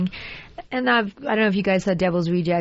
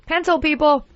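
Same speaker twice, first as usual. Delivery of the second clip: calling out; 8 semitones higher than usual. Real, not acted. Calling for a pencil.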